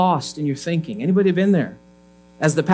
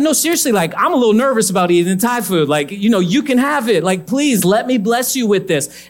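pleading higher-pitched offer, retold; earnest insistence; 5 semitones higher, 4 dB louder than usual. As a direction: re-enact an earnest, raised-pitch plea. Insisting the man take the food.

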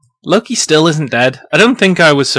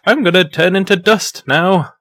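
lightly voiced, higher-pitched character; mock dying announcement; 7 semitones higher than usual. Co-host voices Odin on Earth about to vanish, lightly hammed-up. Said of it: "he's like".

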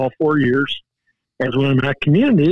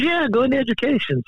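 buddy insisting; 10 semitones higher, faster than usual. Retold or imitated. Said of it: "And he goes".

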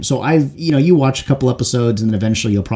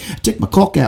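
lightly imitated Scottish accent, pitched up; higher, mock Scottish-accented quote; 10 semitones higher, 4 dB louder than usual. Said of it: "he's got the accent".

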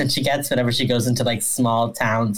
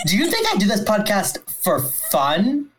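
mock indignant; 8 semitones higher than usual. Mock indignant rant, cohost joins.

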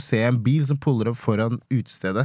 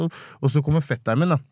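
usual speaking voice

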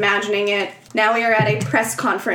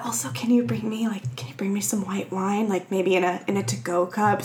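secretive hush; 2 semitones lower, 6 dB quieter than usual. Retold or imitated. Mother sneaking a wine order past her kid, hushed. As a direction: drop to a hush as if slipping it past someone.

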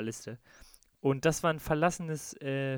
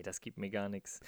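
ordinary talk, nothing marked

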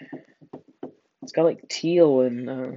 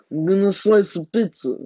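mocking Italian accent; 5 semitones higher than usual. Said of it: "like a drunk Italian".

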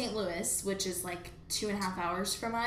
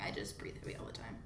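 whisper; 10 semitones lower, 12 dB quieter, faster than usual. Real, not acted. Deadpan aside, whispered.

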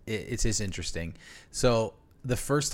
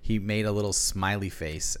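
usual speaking voice

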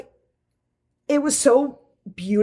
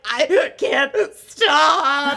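drawn-out, higher wail through imitated tears; mock sobbing; 4 semitones higher, much slower than usual. Telling Dee why she hid behind a wall, mock sobbing. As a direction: wail it slowly, hammed-up crying. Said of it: "just, like, sobbing".

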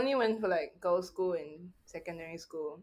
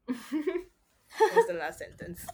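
slow, laughing through words; 7 dB louder, much slower than usual. Real, not acted. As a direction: laugh as you say it. Laughing at the story.

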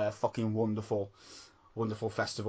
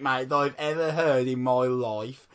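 normal talking voice

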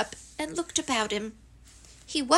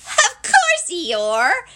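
very high, loud start, dropping on the name; squeaky little character voice; eager Piglet; 6 semitones higher, 6 dB louder, much slower than usual. Acted. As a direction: squeal the assurance. Piglet insists to Eeyore.

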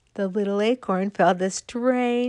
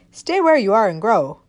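louder, gruff brush-off; rough answer; 8 dB louder than usual. Acted. East wind answering the acorn roughly. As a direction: snap it off roughly. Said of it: "answered rather roughly".

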